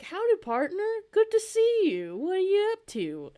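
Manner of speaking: high, loud, friendly cowboy greeting; cowboy robot. Retold or imitated. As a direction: hail a partner in a high, hearty cowboy voice. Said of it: "he's a rootin' tootin' cowboy".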